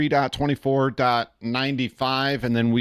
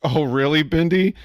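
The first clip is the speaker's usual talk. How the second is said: pitched up and louder, a playful surprised "oh really"; 5 semitones higher, 5 dB louder than usual. Real, not acted. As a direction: react like the chat just caught you off guard. Reacting to a chat message.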